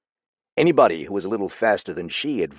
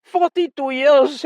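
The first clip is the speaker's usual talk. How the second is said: high, louder, slow; louder second take; voice rising; 8 dB louder, slower than usual. Acted. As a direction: let the voice swell. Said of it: "his voice rising".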